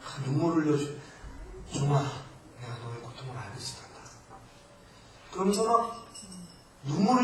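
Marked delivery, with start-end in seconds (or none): 0.0-4.1 soft, lightly voiced comforting Jesus; a hint of tender Jesus consoling; imagined Jesus speaking. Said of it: "예수님이 나를 이렇게 끌어안아 주시면서". (예수님이 위로하시는 목소리를 살짝 부드럽게)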